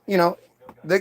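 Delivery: ordinary delivery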